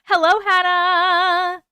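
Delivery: high drawn-out hello; sing-song greeting. Acted.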